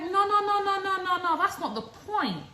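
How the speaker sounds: loud, high, exasperated ranting; boyfriend's rant. Retold or imitated. Boyfriend complaining she made such a fuss, loud and exasperated. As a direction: let the frustration pour out.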